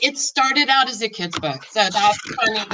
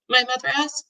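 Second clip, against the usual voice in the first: ordinary delivery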